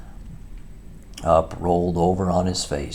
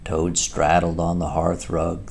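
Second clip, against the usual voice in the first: ordinary delivery, nothing marked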